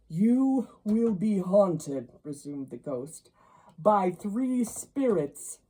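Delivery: loud, slow ghostly doom; grave pronouncement. A narrator's reading. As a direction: announce the hauntings gravely.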